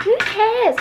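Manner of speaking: mock child yelling high and loud; high kid's shout. Retelling the kid heard in her video, a high mock child's shout. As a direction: squeal it like the creepy background kid. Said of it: "It's literally just".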